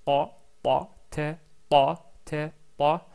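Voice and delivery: « ta » appuyé avec la fatha, fort et net; répétez « ta » fort et clair; ta répété. Shown as a demonstration. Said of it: "commencez d'abord avec la fatha". (« ta » emphatique répété)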